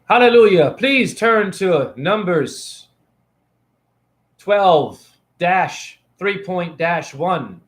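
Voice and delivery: slow, drawn-out preacher voice; hammed-up sermon reading; mock priest. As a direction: intone it like a priest from the pulpit. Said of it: "This is your priest".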